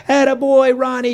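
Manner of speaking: high-pitched family cheer from the stands; cheering yell. Acted. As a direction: yell proud encouragement at the driver. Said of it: "yells the family".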